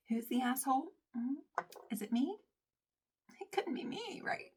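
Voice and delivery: soft, high, rising questions; tiny sheepish voice creeping upward; mock innocence. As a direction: ask it small and high, feigning innocence. Mock-innocent realisation of being wrong.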